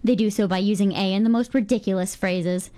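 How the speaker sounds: ordinary talk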